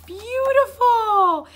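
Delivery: high, loud, slow gush; delighted admiration. Real, not acted. Vlogger admiring calligraphy in her new book, delighted admiration. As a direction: draw it out in delight.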